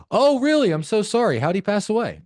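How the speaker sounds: very high, loud, chirpy sympathy; creepily upbeat condolence. Shown as a demonstration. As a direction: react to a death far too brightly. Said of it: "I'd sound like a fucking creep".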